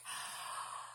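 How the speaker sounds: big audible breath in; deep breath in. Shown as a demonstration. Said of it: "Take a deep breath".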